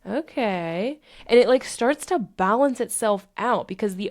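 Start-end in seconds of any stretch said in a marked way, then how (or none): 0.1-1.0 long okay rising gradually; voice of the emotion. Acted. Emotion balancing itself out, slow tentative acceptance. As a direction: stretch it out, coming round. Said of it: "And then the emotion says".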